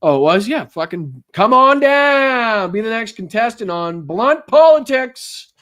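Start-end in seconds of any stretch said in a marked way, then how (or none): 1.3-5.5 high, loud, drawn-out contestant call; game show announcer. Pretend. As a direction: call a contestant down with big showmanship.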